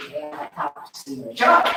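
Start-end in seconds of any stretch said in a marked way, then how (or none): 1.4-1.7 joking charot. Real, not acted. Joking retraction.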